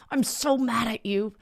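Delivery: put-on high, slow pouty child voice; little kid. Mom imitating a little kid mad at her. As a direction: whine it like a sulky small child. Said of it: "had a little kid be like".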